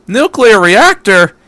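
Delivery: put-on shocked boy can't believe his ears; dumbstruck. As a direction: repeat it in disbelief.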